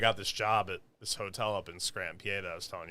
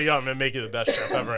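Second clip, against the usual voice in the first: louder, laughing mock chef boast; mock tough chef; 4 semitones higher, 9 dB louder than usual. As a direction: ham up the loudmouth boss, laughing. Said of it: "He was a complete asshole".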